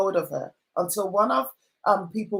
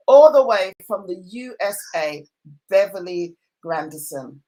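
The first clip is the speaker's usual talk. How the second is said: announcer call; 7 dB louder than usual. Acted. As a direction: announce her like a game show host. Host announces guest grandly.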